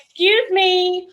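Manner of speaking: stunned new soldier demanding answers; indignant disbelief. Retold or imitated. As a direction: drag it out in shocked indignation. Shocked reaction to warning.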